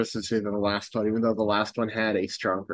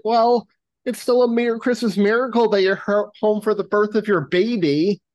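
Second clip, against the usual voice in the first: mock earnest cheer; 8 semitones higher, 4 dB louder than usual. Glenn soothing arguing couple, retold.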